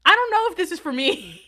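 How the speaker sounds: high, loud imitation of an uneasy kid; awkward protest. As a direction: pitch it up in mock discomfort. Acted kid's reply to awkward gift.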